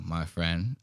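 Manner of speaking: ordinary talk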